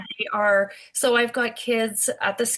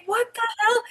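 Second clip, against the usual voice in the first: stunned; 14 semitones higher than usual. Retold or imitated. Retold shock at bite.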